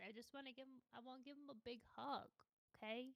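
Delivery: soft, caring voice; gentle, almost whispered pity; soft and tender. Real, not acted. Tender about Silver.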